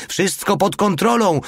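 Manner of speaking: very high-pitched yell, moderate volume; acted screaming. A narrator's reading. Driver reassures the crew while ramming cars, high acted scream. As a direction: shriek it as you floor the accelerator. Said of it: "wrzeszczał i nacisnąwszy akcelerator".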